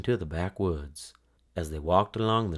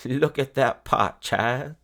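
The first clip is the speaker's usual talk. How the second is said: louder, higher with a laugh in it; 4 semitones higher, 4 dB louder, slower than usual. Acted. Old woman showing Blanche the magic stew pot, laughing. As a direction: chuckle through the words like a pleased old woman. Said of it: "the old woman said, laughing".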